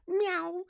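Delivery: a high, playful cat meow. Pretend. Replying to Bethany's cool cat greeting with a high meow.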